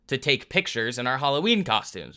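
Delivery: normal talking voice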